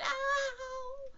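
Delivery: very high, drawn-out "owww"; jolted "ow". Acted. Piglet coming down in the pocket, high pained cry. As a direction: squeal it as if landing with a bump. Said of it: "as he came down, he said".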